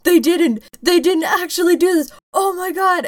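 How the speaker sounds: high-pitched, disbelieving thrill; imitated excitement. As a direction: mimic someone thrilled and amazed, voice jumping up. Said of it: "You did seem very excited".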